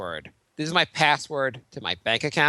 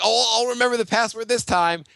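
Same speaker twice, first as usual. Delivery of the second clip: mock confident self; 7 semitones higher than usual. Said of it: "I'm always like".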